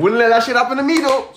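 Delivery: high-pitched insistent protest; emphatic refusal. Real, not acted. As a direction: sing out the refusal, climbing high. Repeats refusal over the others.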